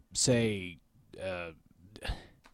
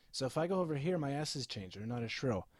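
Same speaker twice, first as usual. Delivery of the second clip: off to the side of the mic; 11 dB quieter than usual. Shown as a demonstration. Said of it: "The S's will change".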